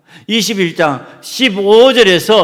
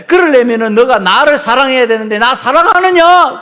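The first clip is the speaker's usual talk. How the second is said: Jesus rebuking Peter; 8 semitones higher, 7 dB louder than usual. Acted. Jesus pressing Peter to remember his calling, loud and insistent. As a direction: drive the calling home to him like a firm master. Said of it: "예수님이요, 사명 다시 깨우쳐 주는 거야".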